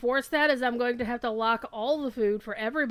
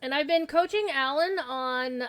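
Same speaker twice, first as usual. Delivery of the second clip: usual speaking voice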